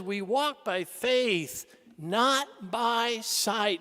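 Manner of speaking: very slow, measured scripture; solemn quote. Retold or imitated. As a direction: let each word land. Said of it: "Saint Paul says".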